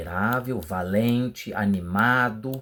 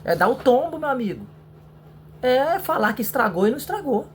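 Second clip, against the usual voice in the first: indignant exclamation; 12 semitones higher, 3 dB louder than usual. Real, not acted. Complaining about dishonest mechanics.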